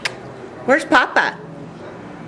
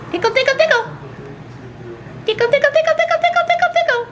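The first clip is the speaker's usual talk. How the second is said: playful squeaky tickle voice, rising higher; giggly tickle attack; 13 semitones higher, much faster than usual. Acted. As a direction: tease the bird with high, singsong tickles. Tickling the pet bird.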